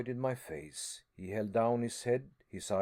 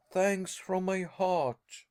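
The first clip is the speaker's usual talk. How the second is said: higher, louder and slow thanks; heartfelt cry; 7 semitones higher, 4 dB louder, slower than usual. A narrator's reading. Harry to his uncle, slow heartfelt cry. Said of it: "I cried".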